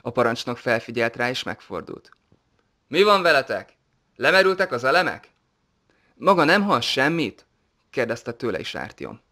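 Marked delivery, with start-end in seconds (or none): 2.9-5.3 higher and louder, calling back down the line; commander's raised, impatient call. A narrator's reading. Commander calls back to the lagging pair. 6.2-7.5 higher, louder and slow, pressing; a raised, slowed, alarmed ask; urgent question. A narrator's reading. Artyom anxiously asks the commander.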